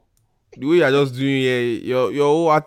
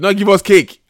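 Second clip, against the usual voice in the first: indignant shout, higher and louder; 8 semitones higher, 8 dB louder than usual. Real, not acted. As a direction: protest loudly, all worked up. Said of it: "Why you shout?".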